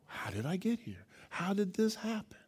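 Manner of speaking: soft shocked question after a gasp; hushed stunned question; dazed disbelief. Retold or imitated. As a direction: wonder aloud how you got here. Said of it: "you're like".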